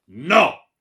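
very loud, high roar; furious refusal. A narrator's reading. Baron rejecting her offer, furious. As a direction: roar it with full force.

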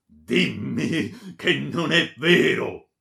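loud, high, drawn-out plea; almost begging. A narrator's reading. Baron begging the friar to deny it, anguished. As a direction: plead in slow, anguished cries. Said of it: "quasi supplicandolo".

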